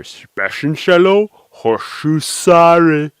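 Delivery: loud, slow, drawn-out backwards speech; "hoshusare" loud and held long, like reversed tape; speaking backwards. Shown as a demonstration. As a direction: say "Specialcello, hoshusare" as stretched reversed syllables. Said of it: "might be easier".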